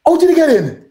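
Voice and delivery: very high imitation of the shopkeeper; acted-out indignant challenge; indignant questioning. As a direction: demand an explanation in a mock high voice. Shopkeeper challenges him in retold story.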